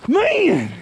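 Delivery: high, loud thrilled shout; excited exclamation. Real, not acted. Celebrating the buck with his partner, a loud high shout. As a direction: let the thrill burst out.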